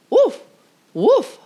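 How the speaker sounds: high, loud yappy woofs; barking dog. A narrator's reading. Little dog barking at the school bus. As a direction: bark it like an eager little dog. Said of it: "barked Titus".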